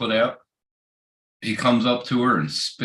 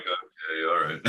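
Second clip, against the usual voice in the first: unimpressed; 4 semitones lower, 7 dB quieter than usual. Retold or imitated. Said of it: "You're like".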